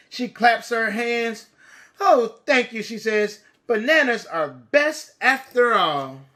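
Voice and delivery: lively, bright storybook reading; reading to children. A narrator's reading. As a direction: read it big for little kids. Storybook narration.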